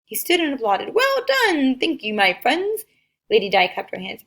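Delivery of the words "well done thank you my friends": delighted. A narrator's reading. King delighted applauding.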